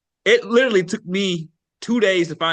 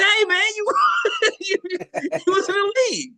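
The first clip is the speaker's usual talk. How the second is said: excited disbelief; 11 semitones higher than usual. Retold or imitated. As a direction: shout it out in amazed disbelief, half laughing. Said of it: "I said".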